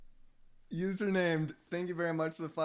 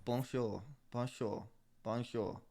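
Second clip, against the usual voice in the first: quiet practice; 6 semitones lower, 9 dB quieter than usual. Shown as a demonstration. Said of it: "Say it".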